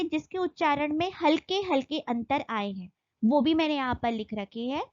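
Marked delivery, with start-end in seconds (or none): none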